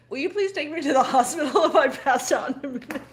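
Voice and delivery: half-laughing, half-pleading for a lift to the hospital; laughing plea. Retold or imitated. Wife laughing as she asks husband for the hospital.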